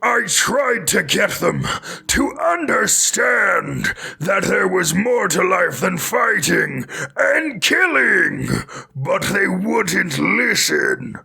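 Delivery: slow, high shouted mutant voice; super mutant voice. Shown as a demonstration. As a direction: drag each phrase out like a huge brute. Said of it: "read in the super mutant voice".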